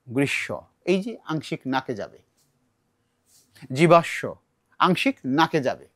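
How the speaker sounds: ordinary delivery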